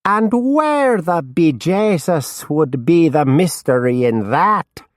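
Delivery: mock Irish brogue, badly done; bad Irish lilt. A narrator's reading. Drunk Norman mocking the detective in a bad Irish accent. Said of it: "in a very bad Irish lilt".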